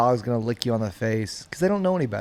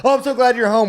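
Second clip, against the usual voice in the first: high, gushing and louder, like an overjoyed dog; eager dog voice; 10 semitones higher, 3 dB louder than usual. Retold or imitated. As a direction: gush like a dog thrilled you're home. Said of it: "the dog's like".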